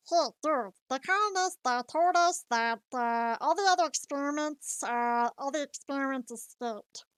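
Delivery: garbled alien cartoon creature voice; Stitch. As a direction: talk like a little cartoon alien, pitch jumping about. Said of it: "Stitch, I don't know if you're".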